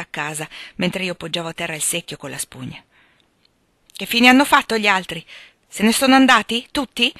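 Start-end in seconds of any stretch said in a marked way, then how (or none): 3.9-7.1 loud, high, alarmed questions; feverish, raised-voice questioning; agitated questioning. Acted. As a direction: demand answers in rising alarm. Patient anxiously asks where everyone went.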